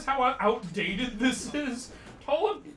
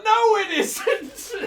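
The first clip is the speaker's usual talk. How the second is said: slow, higher denial cracking into laughter; laughing retort; 9 dB louder, much slower than usual. Real, not acted. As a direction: deny it while laughing.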